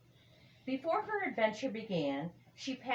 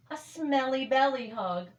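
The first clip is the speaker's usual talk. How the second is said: playful bear; 7 semitones higher, 5 dB louder than usual. Acted. Bear offers playful hug.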